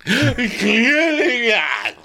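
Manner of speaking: mock sob, drawn out, high; fake sobbing. [name] fake sobbing at Jill, hammed-up crying. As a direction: blubber it theatrically. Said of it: "Fake sobbing".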